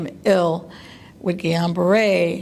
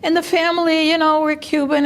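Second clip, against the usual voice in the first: usual speaking voice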